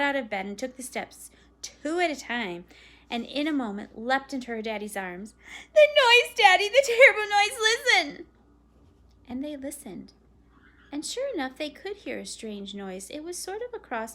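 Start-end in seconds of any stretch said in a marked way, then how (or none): 5.7-8.3 put-on high, loud, frightened child's cry; panicked girl. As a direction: clutch Daddy and beg him to listen. Terrified girl leaps into arms.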